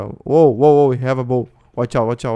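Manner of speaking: sudden alarm at the bow; alarmed warning. Real, not acted. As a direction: warn the others, startled. Alarmed at an armed player.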